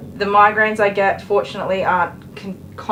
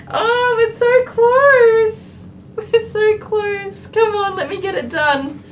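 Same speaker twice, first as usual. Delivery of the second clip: high, drawn-out hammed-up wail; mock crying; 13 semitones higher, 6 dB louder, slower than usual. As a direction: plead in a fake tearful whine. Mock wailing plea to the dog.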